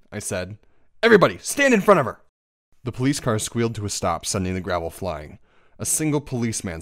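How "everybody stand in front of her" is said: fast, high, loud command; urgent order. A narrator's reading. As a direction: shout a hurried order to the group. Urgent order to hide friend.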